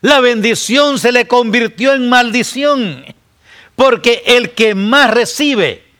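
high, loud warning; full-force preaching. Acted. Preacher warning his congregation, high and loud. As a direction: thunder the warning to the church.

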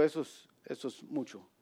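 soft, low, matter-of-fact verdict; quietly voiced divine understatement; understated reply. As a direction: drop it softly, as a calm dismissal. God's understated reply to Gideon.

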